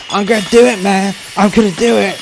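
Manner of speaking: very high, loud, unhinged cry; crazed threat. Retold or imitated. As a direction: yell it like a man about to snap. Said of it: "the guy behind the door going".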